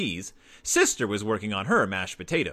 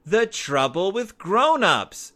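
louder, slower excited shout; group shout; 8 semitones higher, 5 dB louder, slower than usual. A narrator's reading. The cubs naming their play together, excited shout. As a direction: call out the title all together. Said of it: "shouted all the others".

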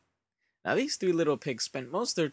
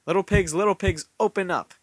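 loud, raised storybook wolf demand; wolf calling out; 6 semitones higher, 5 dB louder than usual. A narrator's reading. Hungry wolf at the hay house door, calling out. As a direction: call it out at a closed door.

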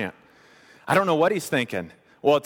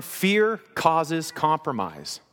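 slow dictation; much slower than usual. Shown as a demonstration. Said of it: "Would you write that down?".

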